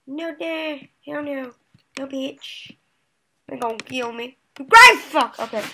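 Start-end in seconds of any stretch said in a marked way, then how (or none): 4.5-5.4 loud angry burst; frustrated outburst. Real, not acted. Player loses lead to opponent, cursing loudly. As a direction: curse loudly at losing the point.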